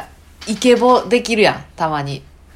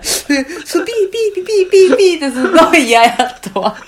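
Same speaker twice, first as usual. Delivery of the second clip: imitated wheeze; 9 semitones higher, 4 dB louder than usual. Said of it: "すっごい嫌やった".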